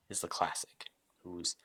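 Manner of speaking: hushed, breathy suspense narration; whisper. Acted. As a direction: whisper it like a secret reveal. GM describes huge man, suspense.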